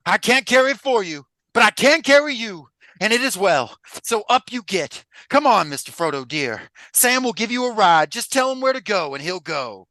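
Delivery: high, loud Southern testifying voice; Sam. Acted. Hobbit offering to carry his friend, fervent Southern testifying. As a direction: testify like a country televangelist. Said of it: "sound like a televangelist".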